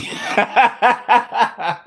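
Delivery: high-pitched burst of laughter; real high cackle; laughing. Real, not acted. Cackles at the argument.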